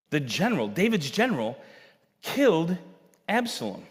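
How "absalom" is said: ordinary talk, nothing marked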